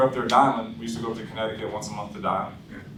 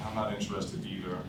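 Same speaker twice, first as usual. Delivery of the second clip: softer, low-key echo of the refusal; flat, uninterested; 4 semitones lower, 10 dB quieter than usual. Shown as a demonstration. Salesman mirroring the prospect's refusal, much softer. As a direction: toss it back like you couldn't care less. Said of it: "I didn't get excited".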